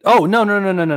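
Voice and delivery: a sudden recollection that tumbles out; excited no no no. Real, not acted. Co-host suddenly remembering the Elvis scene, excitedly.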